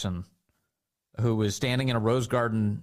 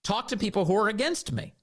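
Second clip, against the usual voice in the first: higher, slow, outraged rebuke; mock indignant tirade; 8 semitones higher than usual. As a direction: jab each how dare you like a finger in the face. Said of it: "gets in his face and he said".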